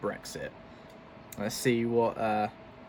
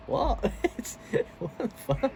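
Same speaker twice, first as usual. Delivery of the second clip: baffled; 11 semitones higher than usual. Real, not acted. Baffled by the odd clip.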